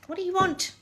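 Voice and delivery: high, soft pet voice; cat-talk voice. Real, not acted. Owner asking her cat what she wants in baby talk.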